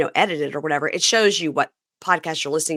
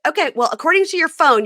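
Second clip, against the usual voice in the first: high, louder mock-arguing comeback; retold retort; 10 semitones higher, 5 dB louder than usual. Retelling how she challenged her friend's denial, insistent. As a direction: push back, replaying the squabble.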